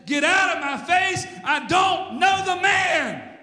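high shouted denial, drawn out at the end; loud, soaring pulpit storytelling; full-force preaching. Acted. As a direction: act out Peter's furious cursing. Preaches Peter's cursing at full force.

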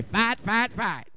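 put-on light Kentucky accent cheer; imitated Southern cheer, vowels drawn toward "fat"; Southern accent cheerleaders. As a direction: chant it like cheerleaders with a Southern drawl. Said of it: "The only time I really notice the Southern accent".

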